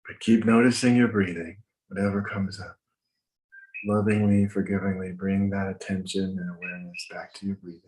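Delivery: calm, measured breathing instructions; walk them through each breath gently; guided meditation. Acted.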